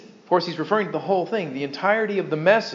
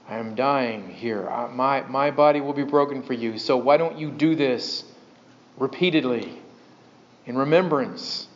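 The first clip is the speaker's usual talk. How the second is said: solemn, tender plea; 4 semitones lower than usual. Retold or imitated. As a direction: lightly voice a dying teacher's gentle request. Voicing Jesus at the last supper.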